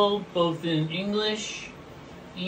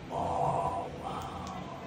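hushed amazement at something lovely; awed whisper; 9 dB quieter than usual. Real, not acted. Host marveling at the pot, whispered awe. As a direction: breathe it out in wonder, no voice.

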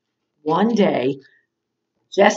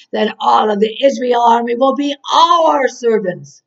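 Goliath's shout; 3 semitones higher, 4 dB louder than usual. Acted. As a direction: shout a giant's challenge to a whole army. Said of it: "Goliath would come out and shout".

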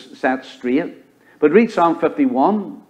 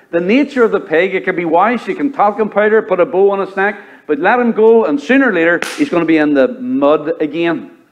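rising preacher's fervor, building louder; fiery preaching; 4 semitones higher, 4 dB louder than usual. Acted. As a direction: preach it harder toward the end. Preacher driving the point home.

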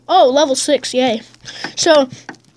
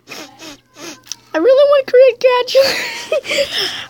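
high, slow wailing shout; crying shout; 12 semitones higher, 3 dB louder, much slower than usual. Real, not acted. Gamer wails in mock despair, high and slow. Emotional outburst mid-battle.

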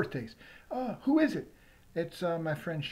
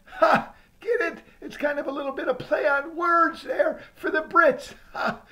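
loud higher laughter through the words; laughing at own joke; 9 semitones higher, 7 dB louder than usual. Real, not acted. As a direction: cackle proud of the pun.

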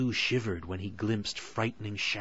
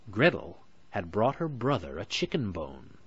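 usual speaking voice

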